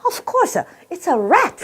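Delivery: high mock-accent reveal; Chinese waiter. Waiter finally reveals the dish to a customer, high mock accent. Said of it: "The guy goes".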